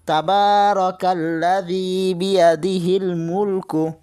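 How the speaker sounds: slow, drawn-out chanted recitation of the verse; slow Quran recitation. Shown as a demonstration. Teacher reciting the verse for students, slow and chanted. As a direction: recite it slowly, drawing out each vowel.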